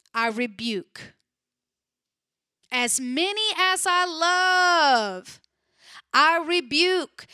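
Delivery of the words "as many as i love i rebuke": louder, higher repeat. Shown as a demonstration.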